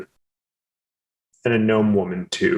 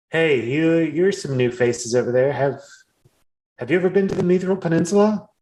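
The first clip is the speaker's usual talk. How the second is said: orc woman calling out; 7 semitones higher than usual. Acted. Orc woman calls to newcomers.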